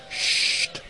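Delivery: breathy, unvoiced shush; whispered hush. A narrator's reading. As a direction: hush them in a secretive whisper. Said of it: "zei de wijze priester". (fluisterend, geheimzinnig sst)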